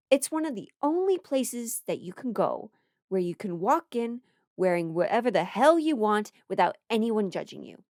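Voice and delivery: normal talking voice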